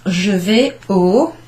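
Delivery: Je vais au drawn out, rising, leaving it hanging; slow setup that leaves the end open; teasing slow lead. Shown as a demonstration. Teacher leaving a sentence hanging for learners to guess. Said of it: "can you guess what I'm gonna say".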